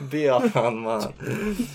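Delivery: a joking Jamaican voice; Jamaican accent. Retold or imitated. Hammed-up Jamaican accent, answering with a laugh. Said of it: "More like".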